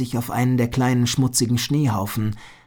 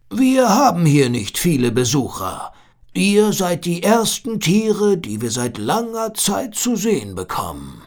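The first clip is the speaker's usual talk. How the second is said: seal voice; 5 semitones higher than usual. A narrator's reading. Seal speaks. (langsame, gutmütige Seehundstimme)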